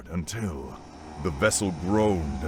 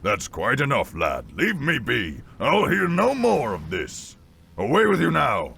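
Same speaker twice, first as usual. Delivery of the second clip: irritated brush-off, growing higher and louder; mildly cross old salt losing patience; stern helmsman; 6 semitones higher, 5 dB louder than usual. A narrator's reading. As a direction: dismiss the lad sternly, building in force. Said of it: "The helmsman no longer wore a grin".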